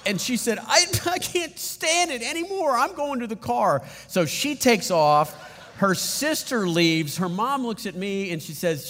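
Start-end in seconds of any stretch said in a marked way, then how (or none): 0.6-3.8 upset little daughter. Retold or imitated. Upset daughter quoted by father.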